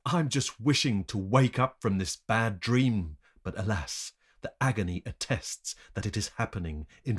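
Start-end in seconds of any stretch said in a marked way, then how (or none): none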